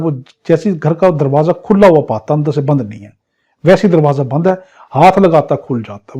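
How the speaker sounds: ordinary delivery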